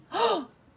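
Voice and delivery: high startled intake of breath; sharp gasp. Acted. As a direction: gasp in alarm. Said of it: "gasped the third little javelina".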